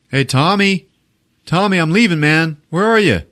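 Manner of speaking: imitated calling out. Departing roommate looks for Tommy, calling out. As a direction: call through the rooms for someone.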